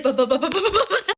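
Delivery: rapid laughing buh buh heartbeat sounds; heartbeat noise. Retold or imitated. Telling viewers about panic in class, laughing heartbeat sounds. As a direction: imitate a pounding heart, giggling.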